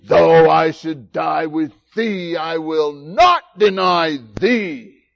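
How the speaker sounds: loud, slow, chest-out vow; booming, drawn-out boast, every word hammered; huffy and puffy. Acted. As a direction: declare it with puffed-up indignation. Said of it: "he gets all huffy and puffy".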